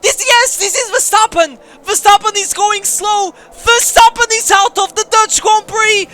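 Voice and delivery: high, loud, thrilled commentary yell; excited shouting. Real, not acted. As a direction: erupt as the leader drops out. Rival crashes out, commentator erupts.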